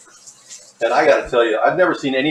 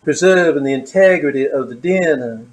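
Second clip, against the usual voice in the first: impersonating that woman; 2 semitones higher than usual. Retold or imitated. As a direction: purr the cooking steps like an eerie villain. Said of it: "I just love impersonating that woman".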